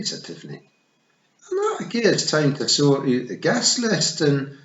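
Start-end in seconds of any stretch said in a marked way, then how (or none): 1.5-4.6 Scottish Feegle. A narrator's reading.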